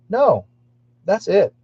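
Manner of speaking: high, loud, emphatic retold protest; firm refusal. Dreamer stops and refuses the chasing witch, emphatic retold refusal. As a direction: put your foot down with a sharp rise.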